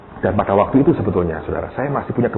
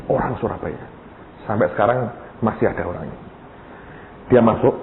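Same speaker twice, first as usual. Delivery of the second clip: usual speaking voice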